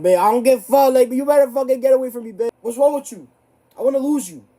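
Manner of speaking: high, loud shrieking at a clingy partner; squealing loud breakup fight; mock lovers' quarrel. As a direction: yell it like a fed-up girlfriend. Voices a fight with the gloves.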